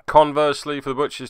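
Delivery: ordinary talk, nothing marked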